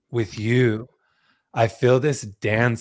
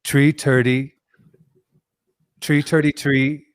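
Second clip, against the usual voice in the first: bad Irish accent, hammed up; mock Irish accent, dropping the th; 3 semitones higher than usual. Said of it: "My Irish accent".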